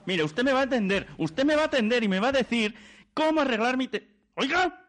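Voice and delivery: enraged caller at the end of his tether; furious demand. Real, not acted. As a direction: demand help, voice climbing into rage. Caller explodes at phone agent.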